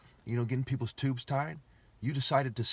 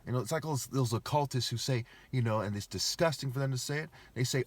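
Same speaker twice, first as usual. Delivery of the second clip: usual speaking voice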